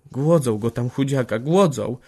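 mild wailing complaint, pitch rising; a touch of a high lament; plaintive moan. A narrator's reading. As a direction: moan it with pity for the starved man. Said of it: "jęknęła zawodliwie".

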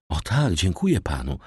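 ordinary delivery, nothing marked

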